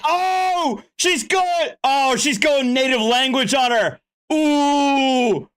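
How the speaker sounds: excited outburst. Real, not acted. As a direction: cry out, delighted by the drama.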